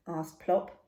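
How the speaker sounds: ordinary delivery, nothing marked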